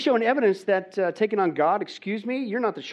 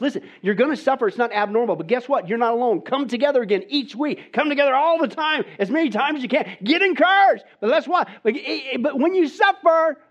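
rising, louder and higher exhortation building to a shout; preaching at full force; 6 semitones higher, 4 dB louder than usual. Acted. Preacher urging the church to keep gathering, full-force preaching. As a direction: pound it home to the congregation.

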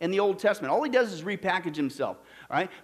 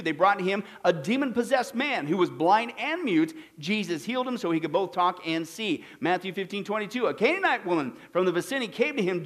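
normal talking voice

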